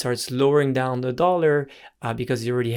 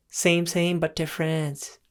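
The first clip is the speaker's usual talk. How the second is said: higher-pitched mock Thai accent; playful Thai accent impression, pitched up; 4 semitones higher, slower than usual. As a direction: redo it as a fuller, high-voiced Thai accent. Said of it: "That was much better".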